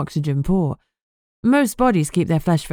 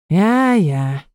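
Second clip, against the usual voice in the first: drawn-out, put-upon agreement; show of sighing; 2 semitones higher, 3 dB louder than usual. A narrator's reading. Detective grudgingly agreeing to pay, mock sigh. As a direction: make a mock-weary show of it. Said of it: "I made a show of sighing".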